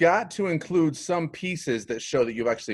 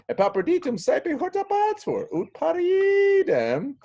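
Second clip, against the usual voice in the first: high-pitched, intense declaiming; dramatic Latin reading; 4 dB louder than usual. Acted. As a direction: urge it on in a high theatrical voice.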